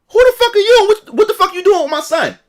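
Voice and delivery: mother shouting at the dad over her son; angry mother yelling. Retold or imitated. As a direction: scream at the stranger at the door.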